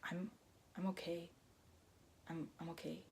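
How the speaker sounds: soft whisper of reassurance; hushed, hiding the struggle. Retold or imitated. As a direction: whisper it, waving them off.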